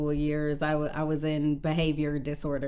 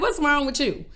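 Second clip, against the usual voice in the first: put-on incredulous teen; 9 semitones higher, 3 dB louder than usual. Teen confronting crying mom.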